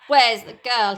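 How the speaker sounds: loud growled demand. Acted. Hook returning to the dinghy, loud growl. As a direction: bark it out like an angry captain. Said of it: "he growled".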